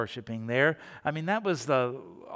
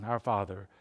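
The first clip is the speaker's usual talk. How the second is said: low, soft, empty recitation; mumbled rote prayer; 6 semitones lower, 5 dB quieter than usual. Retold or imitated. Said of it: "they're going".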